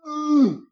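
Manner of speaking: high drawn-out hmm; a hammed-up pondering hum; mock hmm. Pretending to weigh the offer, high mock hum.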